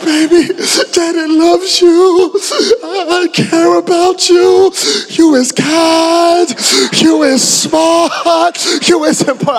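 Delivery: high, slow, cooing daddy voice; tender baby talk. Retold or imitated. Father saying a teary goodbye to his newborn, high slow coo. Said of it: "But I was like".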